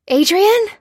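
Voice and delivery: high, loud questioning call; searching cry. A narrator's reading. Lucy searching the empty ward, high loud call. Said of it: "she cried".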